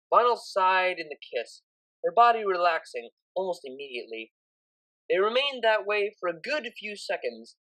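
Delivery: ordinary delivery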